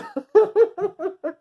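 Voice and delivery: high cackling laugh, real; loud high laugh. Real, not acted. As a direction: crack up at your own line. Laughing hard at own joke.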